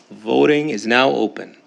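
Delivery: ordinary delivery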